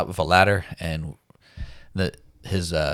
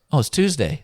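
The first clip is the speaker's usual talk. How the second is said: a higher-pitched hint of the wife; light wife voice; 9 semitones higher, 4 dB louder than usual. Retelling the wife telling him the day, lightly higher. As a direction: casually mimic her answer.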